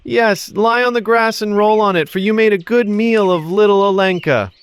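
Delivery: high, mocking echo; boy taunting. Boy mocking the dragons from the treetop, high. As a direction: taunt them from the tree. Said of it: "Telesic called".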